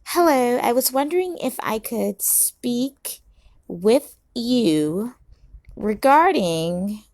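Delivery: put-on sweet and proper, like a polite young woman.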